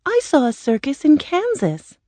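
put-on high-pitched girl's voice; high, girlish character voice; Dorothy. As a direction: share it eagerly as a young girl. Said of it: "Dorothy said".

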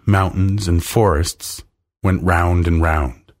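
ordinary delivery, nothing marked

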